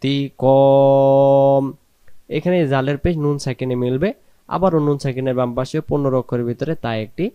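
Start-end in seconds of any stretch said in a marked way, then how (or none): 0.0-1.6 তি কম loud, long held o closing on m; pausal ending. Shown as a demonstration. Teacher showing the pause form, loud drawn-out তি কম closed on m. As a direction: stretch কম and drop the final i. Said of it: "দুই জিরকে আমরা বাদ দেব".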